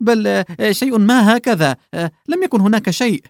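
a fussy clerk caught in his lie; flustered old man. Acted. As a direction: backpedal frantically with little ums. Old man panics over slip.

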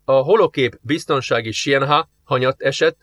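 usual speaking voice

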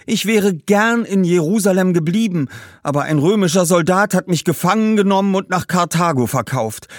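high, loud pleading ghost; great-grandmother ghost. A narrator's reading. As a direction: coax him to become Jona. (hoch und laut werbende Ur-Urgroßmutter)

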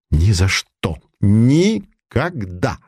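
each word clipped, Никогда slow; staccato. Acted. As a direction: chop Никогда out syllable by syllable. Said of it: "отчеканил Савин".

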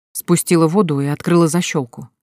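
ordinary delivery, nothing marked

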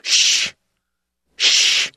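long voiceless "shh"; two sharp, sustained shushes; shushing. A narrator's reading. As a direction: hush someone in a theater. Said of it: "people ... making shushing noises".

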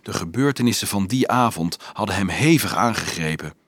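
ordinary delivery

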